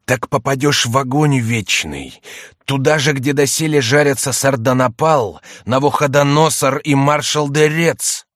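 menacing voice. A narrator's reading. Mysterious voice threatens eternal fire, menacing. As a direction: thunder out the punishment deliberately. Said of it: "приняв грозное выражение".

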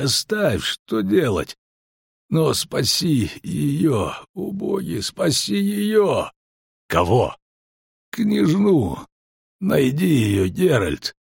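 high, slow desperate pleading; wounded soldier. A narrator's reading. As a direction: beg with the last strength. Dying man begs for princess. (мольба умирающего)

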